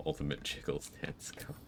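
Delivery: whispered anime attack call; overacted anime dub. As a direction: whisper it like a secret anime move. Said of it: "an anime character's, like, ultimate technique".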